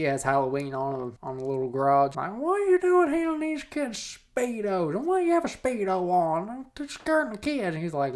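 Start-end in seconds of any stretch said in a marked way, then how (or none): 2.3-7.7 high-pitched neighbor scolding; mock yelling. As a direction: scold him in a put-on, very high voice. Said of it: "she goes yelling at Chris".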